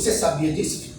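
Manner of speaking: low, quiet voice of God asking his son; lightly voiced God, low and soft; God asking Jesus. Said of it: "Aí o Deus vai olhar para Jesus".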